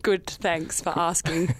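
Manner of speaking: put-on slow, polite Canadian accent. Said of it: "You're very Canadian all of a sudden".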